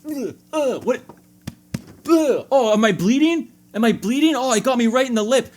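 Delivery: badly faked alarm over a bloody lip; mock injury. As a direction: pretend you just took a stick to the face. Mock getting hit by bat.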